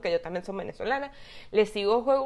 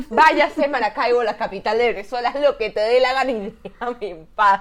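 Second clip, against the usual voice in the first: exasperated shouting; 2 semitones higher, 9 dB louder than usual. Acted. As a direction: send him packing at full voice, half laughing. Imagined retort to the man, laughing. (grito exasperado entre risas)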